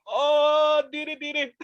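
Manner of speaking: a hammed-up squeak of alarm; fluttery mock-old-lady panic; mock fright. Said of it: "and you're like".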